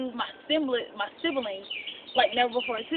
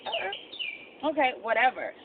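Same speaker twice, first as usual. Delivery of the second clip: sassy dismissal; 4 semitones higher, slower than usual. Retold or imitated. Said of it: "And I was like".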